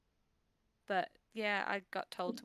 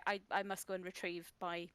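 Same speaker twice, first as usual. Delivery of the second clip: ordinary delivery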